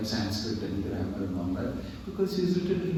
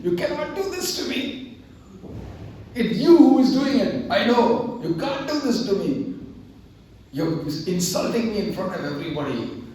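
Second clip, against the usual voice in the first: much higher and louder, anguished outcry; screaming at the deity; 9 semitones higher, 5 dB louder than usual. Retold or imitated. Humiliated scholar screaming at the deity in the temple. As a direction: rage at God for the public shame. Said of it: "started screaming at the deity".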